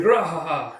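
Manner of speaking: loud belly laugh, rahaha; hearty booming laugh. Acted. Kuroku bursts in laughing loudly with his news. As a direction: laugh it out big and proud.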